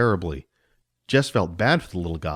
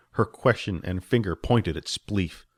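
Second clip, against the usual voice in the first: usual speaking voice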